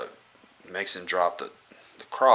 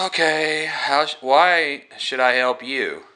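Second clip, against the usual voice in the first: reluctant; 7 semitones higher, 4 dB louder than usual. Retold or imitated. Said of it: "he says that".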